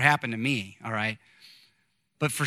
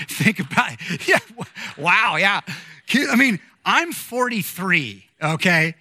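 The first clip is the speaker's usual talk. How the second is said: amazed; 4 semitones higher, 3 dB louder than usual. Real, not acted. Pastor marvels, laughing.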